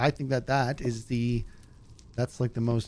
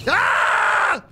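sudden shout; 8 dB louder than usual. Real, not acted.

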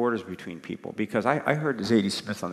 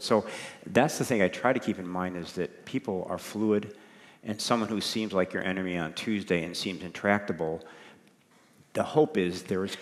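ordinary talk

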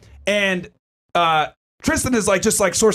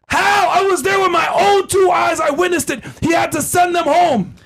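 high-pitched, emphatic protest; indignant eyewitness insistence; 9 semitones higher than usual. Real, not acted. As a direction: insist way up high, pleading the case. Rebutting accusers as an eyewitness.

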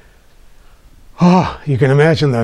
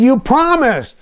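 put-on sulky child; 12 semitones higher, slower than usual. Sulking child accuses mother.

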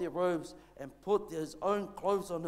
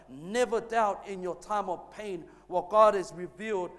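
louder unison repeat; 2 semitones higher, 7 dB louder than usual. Shown as a demonstration. Congregation says the line back with the pastor, louder. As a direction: lead the church in saying it back, stronger. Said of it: "Let's say that together".

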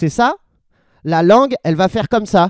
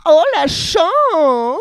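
high, drawn-out exclamation; mock excitement; 8 semitones higher, 3 dB louder, much slower than usual. Reacting to getting the easy technique, hammed-up envy.

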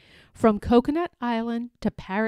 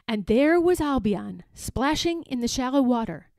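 usual speaking voice

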